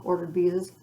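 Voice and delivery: usual speaking voice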